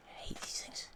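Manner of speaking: hushed whispered complaint; whispering. Real, not acted. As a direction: whisper it, annoyed.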